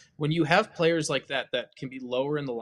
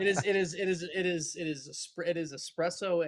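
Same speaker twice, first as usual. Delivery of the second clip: too much caffeine; 5 semitones higher than usual. Real, not acted. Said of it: "I am wired right now".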